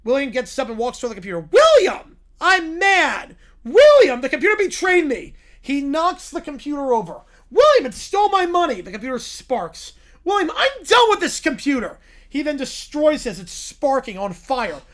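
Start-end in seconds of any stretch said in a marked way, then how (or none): 1.4-5.3 high, loud furious outburst; angry yell. Acted. Angry mom yelling at son. 7.5-8.8 high, loud furious outburst; angry yell. Acted. Angry mom yelling. 10.2-12.0 high, loud furious outburst; angry yell. Acted. Angry mom yelling.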